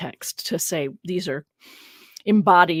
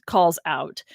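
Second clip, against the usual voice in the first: usual speaking voice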